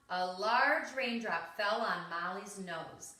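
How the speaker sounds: ordinary delivery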